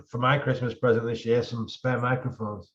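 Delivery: ordinary delivery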